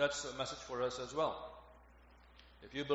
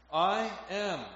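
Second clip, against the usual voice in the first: "I am" high, loud and weighty; emphasis on "I am"; 7 semitones higher, 8 dB louder than usual. Shown as a demonstration. Preacher quoting Jesus's declaration, "I am" strongly stressed. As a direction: proclaim "I am" with both words stressed. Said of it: "an emphasis here on those first words".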